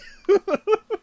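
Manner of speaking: high burst of laughter; loud laugh. Real, not acted. Friend laughing hard at her own punchline.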